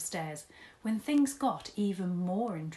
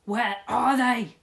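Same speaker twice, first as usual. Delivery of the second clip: loud furious yell, slowed and drawn out; 6 semitones higher, 12 dB louder, slower than usual. Acted. Mister Tugg yelling up the stairs in a rage. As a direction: bellow it. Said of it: "he yelled again".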